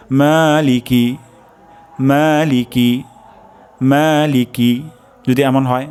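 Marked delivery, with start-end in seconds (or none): 0.1-4.8 মালিকি with long মা and short লিকি, slowly; correct Maliki. Shown as a demonstration. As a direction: stretch মা in মালিকি, say লিকি quick. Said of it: "লিকি, এখানে টান হবে না". (মালিকি: মা টেনে, লিকি দ্রুত)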